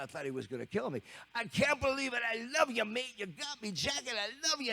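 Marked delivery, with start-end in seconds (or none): 1.4-4.7 high, gushing British voice; overjoyed English drummer. Retold or imitated. [name] thanking the kid for his jacket, high gushing English voice. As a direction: gush with delight, mate.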